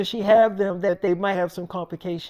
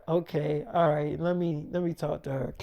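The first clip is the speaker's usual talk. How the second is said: low and soft, resigned; quiet resigned okay; 5 semitones lower, 6 dB quieter than usual. Retold or imitated. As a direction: mutter it like you've given in. Resigned reply to nurses.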